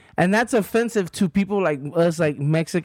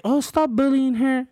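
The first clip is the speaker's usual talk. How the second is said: mock pleading; 5 semitones higher than usual. Mocking online defenders of the landlady, whiny plea. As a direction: parody the people defending her. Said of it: "trying to say".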